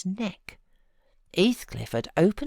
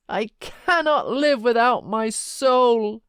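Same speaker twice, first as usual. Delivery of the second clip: higher, louder, slow howl of grief; peak of despair; 7 semitones higher, 7 dB louder than usual. A narrator's reading. Heathcliff's grief peaks for Catherine, high, loud and slow. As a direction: stretch CANNOT into a slow desperate wail. Second, stronger repetition.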